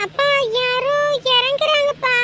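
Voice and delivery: high, carrying report to father; excited calling out. Acted. Child telling father someone is getting down, high excited call. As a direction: sing out news of arrivals.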